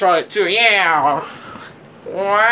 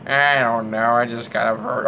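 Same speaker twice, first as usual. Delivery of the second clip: ordinary delivery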